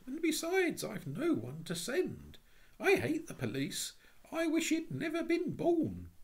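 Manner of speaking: put-on high, bright child's voice; a high little-girl voice. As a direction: call out like an eager little girl. Jane's voice.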